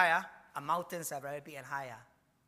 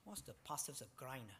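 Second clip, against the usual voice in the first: quiet aside; 5 semitones lower, 14 dB quieter than usual. Real, not acted. Preacher gropes for a word.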